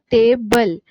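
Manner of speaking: "Table" with a forceful, long "टे"; stress on first syllable. Shown as a demonstration. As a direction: punch the "टे" of "table", then a separate "बल". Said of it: "टे में हम इसमें stress डाल रहे हैं". ("टेबल" में "टे" पर ज़ोर)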